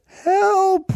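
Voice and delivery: high mock cry for help; a quick high yelp of "Help!"; retold shout. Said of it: "as she shouted".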